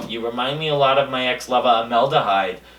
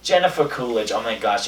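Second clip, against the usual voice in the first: ordinary talk, nothing marked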